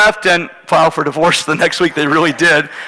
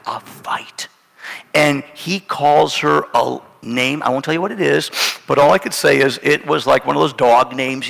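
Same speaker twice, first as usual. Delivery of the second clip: usual speaking voice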